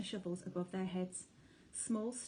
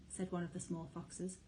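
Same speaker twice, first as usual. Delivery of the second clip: ordinary delivery, nothing marked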